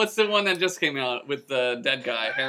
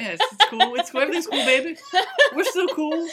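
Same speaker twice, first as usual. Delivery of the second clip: teasing reassurance; 13 semitones higher than usual. Pretend.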